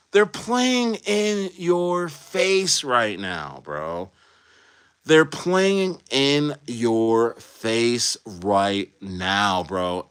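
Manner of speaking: very slow, stretched emphasis; drawn out. Real, not acted. Streamer insisting to chat, stretched slow. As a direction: drag every word out.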